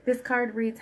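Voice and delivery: ordinary talk, nothing marked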